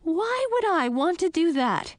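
high-pitched, incredulous question; appalled surprise. Acted. Princess refusing Frog's kiss suggestion, high and incredulous. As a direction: protest in a high, astonished voice.

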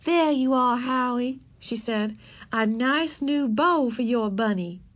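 a sweet schoolteacher giving a present; lightly voiced Miss Binny, slow and kind; kind teacher voice.

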